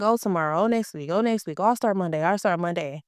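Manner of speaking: ordinary delivery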